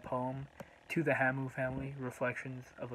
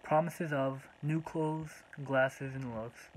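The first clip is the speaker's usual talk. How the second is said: measured poem reading, long pauses between short phrases; poem recitation. Acted. Poet reading a tribute to the Hamu family, measured recitation. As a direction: recite line by line, letting each phrase stand alone.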